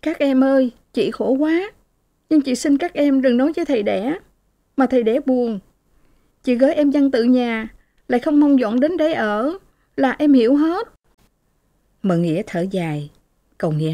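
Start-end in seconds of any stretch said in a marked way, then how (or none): 0.0-10.9 higher, tearful pleading with the siblings; sobbing plea. Acted. Lệ begs her siblings through tears, pleading higher. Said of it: "nức nở khóc".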